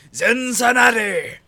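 loud, high, stretched cheer; excited shout. Real, not acted. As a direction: belt out the city name. Cheering the city name.

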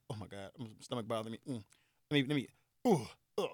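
soft, low sick groaning; acted queasiness. Drinker fighting a bad stomach, acted queasy murmur. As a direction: groan quietly as if about to throw up. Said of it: "I'm sitting there like".

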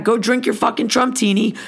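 ordinary delivery

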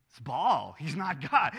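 ordinary talk

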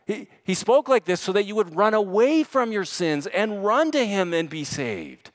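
high, pleading pulpit cry; impassioned preaching. Real, not acted. Preacher urging sinners to run to Jesus, impassioned. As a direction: plead with them at full force.

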